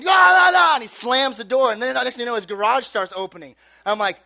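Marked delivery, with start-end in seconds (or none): 0.0-0.7 high, loud gibberish yelling; mock screaming. Cop screaming at kids from his doorway, high loud gibberish. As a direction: imitate an enraged man's wordless screaming. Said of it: "He's just screaming".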